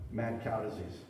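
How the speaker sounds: ordinary talk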